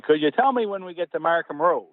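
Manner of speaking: high-pitched Newfoundland lilt; Newfoundland accent. Retold or imitated. Bus passenger asking the driver for his stop, Newfoundland accent. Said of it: "identify his Newfoundland accent".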